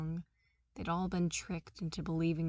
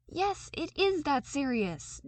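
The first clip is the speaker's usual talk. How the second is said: much higher and louder, insistent; indignant insistence; contradicting; 9 semitones higher, 6 dB louder than usual. Acted. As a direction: flatly overrule her. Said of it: "Darcy contradicted her".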